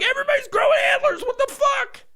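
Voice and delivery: very high fast shrieking shout; high fast screaming panic; 1 panicked shout. Pretend. Seeing everyone with antlers.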